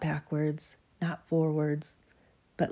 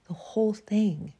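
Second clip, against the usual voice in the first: whispered; 5 semitones higher than usual. Acted. Dramatic reading of confession.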